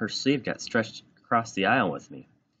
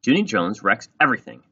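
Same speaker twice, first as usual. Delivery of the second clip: grouchy, louder complaint to the teacher; 4 semitones higher, 7 dB louder than usual. A narrator's reading. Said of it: "she grouched".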